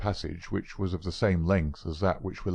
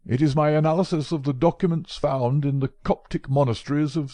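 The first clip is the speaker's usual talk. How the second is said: put-on higher, louder old scholar, proud then fretful; proud old professor; 7 semitones higher, 5 dB louder than usual. Old professor showing Holmes his manuscript, higher and louder. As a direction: boast of the great work, then tease Holmes.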